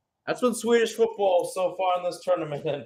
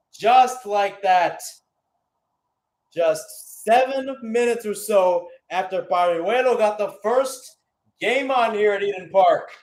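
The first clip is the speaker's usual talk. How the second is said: lingering excitement; 5 semitones higher, 4 dB louder than usual. Real, not acted. After the equaliser.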